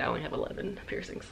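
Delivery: breathy half-whisper, dropped right down; whispered afterthought; whispered aside. Real, not acted. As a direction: toss it off under your breath. Quiet aside after rejected piercings.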